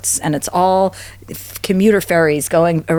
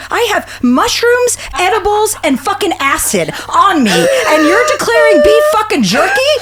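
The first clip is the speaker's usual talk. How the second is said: climbing from sharp disbelief to shrieking fury, much higher by the end; incredulous rant; 11 semitones higher than usual. Retold or imitated. Scolding her cousin at customs, building to an outraged shriek.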